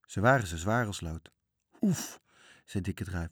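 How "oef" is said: effort grunt. Acted. Lifting a heavy chest.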